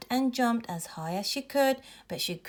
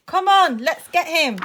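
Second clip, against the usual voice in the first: raised voice, rising to an urgent shout; calling out; 3 semitones higher, 6 dB louder than usual. A narrator's reading. Boy on the slide rallying friends, excited calling out. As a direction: call to friends, getting louder and higher. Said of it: "he called".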